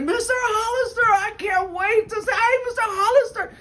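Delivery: very high, squeaky imitation of an excited kid; eager teen attendant. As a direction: play the gushing young fan at the counter. Voices eager young attendant.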